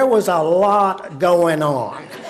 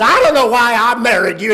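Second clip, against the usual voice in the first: loud, quick, high retort; quarrelling wife; 7 semitones higher, 7 dB louder, faster than usual. Retold or imitated. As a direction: fire it angrily at the husband. Mother snaps back in quarrel.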